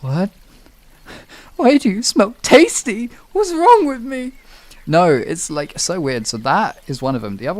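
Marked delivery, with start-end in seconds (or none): 0.0-4.3 high, loud, laughing disbelief; shrieking what is wrong with me; baffled disbelief. Retold or imitated. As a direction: squeal in confused shock at yourself. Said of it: "and I'm like".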